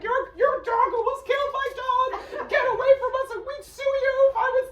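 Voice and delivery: very high, loud frantic shriek; hysterical screaming. Retold or imitated. Dog sitter screaming at the kids, hysterical. Retold screaming at the dog's walkers.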